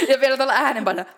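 talking through laughter; amused, fast and laughing. Real, not acted. Laughing while commenting.

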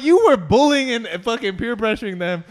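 high, loud accusing protest; re-enacted outraged comeback; indignant retort. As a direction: throw the blame back, voice shooting up. Throws the blame back at aunts.

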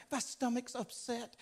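soft, whiny, half-crying complaint; mock whimpering player. Preacher mocking a tennis player's excuse, whimpering softly. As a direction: whine like a pampered athlete. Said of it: "He's like".

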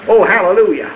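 ordinary talk, nothing marked

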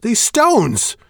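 very loud, high furious yell; explosive shout. Acted. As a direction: explode with scorn. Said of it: "Shane explodes".

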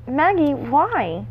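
put-on a child unsure whether to worry; odd uncertain tone. A narrator's reading. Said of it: "The odd tone".

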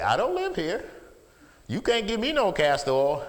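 mock childish backtalk; defiant visiting child. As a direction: protest like a child refusing medicine. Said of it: "I dare you to say".

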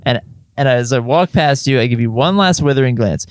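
ordinary delivery

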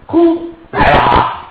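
a high, slow roaring shout as the beast springs; tiger god roar. Acted. Tiger god springing from behind the curtain, roaring shout. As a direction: roar it out like a tiger spirit leaping.

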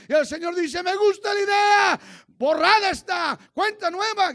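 high, very loud cry with stretched words; full-force preaching. Real, not acted. Preacher leading the church in a psalm of forgiveness, full-force shouting. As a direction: shout it out as an impassioned plea.

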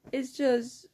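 normal talking voice

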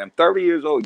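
indignant protest. Retold or imitated. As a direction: insist on being grown, voice lifted. Indignant retort to condescending relatives.

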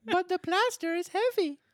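put-on very high, loud puppet-boy voice; complaining Pinocchio. Pinocchio objecting to the bricklayer's job, high complaining voice. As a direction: whine like a workshy little puppet.

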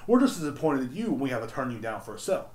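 ordinary talk

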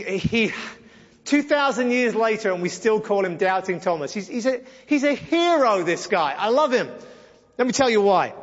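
usual speaking voice

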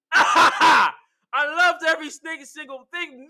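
high, loud burst with laughter; gleeful raving. Real, not acted. Fan raving about the segment, laughing and loud. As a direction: gush with laughing glee.

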